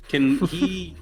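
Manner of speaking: normal talking voice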